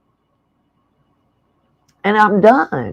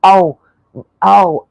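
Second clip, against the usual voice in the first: normal talking voice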